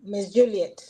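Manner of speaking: ordinary talk, nothing marked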